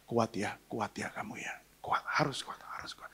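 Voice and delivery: soft whisper, fading almost to nothing; whispered comfort. Retold or imitated. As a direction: hold him close and whisper courage. Wife comforts him in embrace. (istri berbisik menguatkan suami yang baru divonis kanker, lirih)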